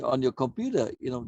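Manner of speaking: ordinary talk, nothing marked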